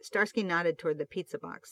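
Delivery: usual speaking voice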